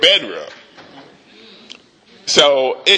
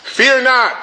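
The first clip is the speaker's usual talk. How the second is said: bold command; 8 semitones higher than usual. Acted. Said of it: "the prophet answered".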